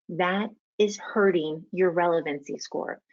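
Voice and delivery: usual speaking voice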